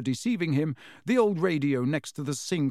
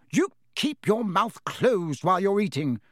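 sharp, louder scolding snap; snapping; 5 semitones higher, 3 dB louder than usual. A narrator's reading. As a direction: snap at him like a stern mum. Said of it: "Missus Weasley snapped".

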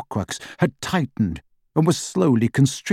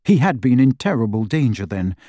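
ordinary delivery

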